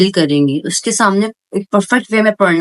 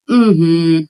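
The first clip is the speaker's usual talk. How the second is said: bored hmm; 4 semitones lower, much slower than usual. Shown as a demonstration. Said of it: "एक फिर यूं bore वाली आई".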